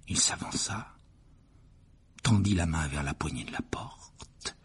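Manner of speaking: chuchoter le récit, plein de suspense; narration chuchotée. A narrator's reading. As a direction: souffler chaque mot à voix basse. (récit murmuré et inquiétant)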